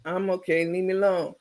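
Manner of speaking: no conviction. Retold or imitated. [name] repeating Carmen's line, flat and lifeless. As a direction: mumble it without meaning it. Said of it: "You gotta say it with some sense".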